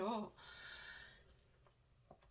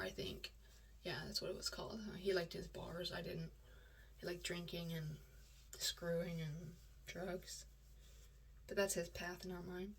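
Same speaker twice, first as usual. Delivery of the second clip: quiet aside; 4 semitones lower, 13 dB quieter than usual. Real, not acted. Bitter aside.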